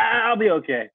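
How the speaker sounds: high-pitched dismissive reassurance; mock brushing-off. Cyclist mimicking himself refusing to make trouble, dismissive. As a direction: wave it away lightly in self-parody. Acts out shrugging off injuries.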